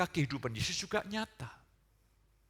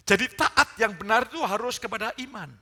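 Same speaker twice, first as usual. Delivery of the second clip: loud, high pulpit proclamation; preaching at full force; 10 semitones higher, 12 dB louder than usual. Real, not acted. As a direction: proclaim the verse at full volume, high above normal talk. (khotbah berapi-api dengan suara lantang)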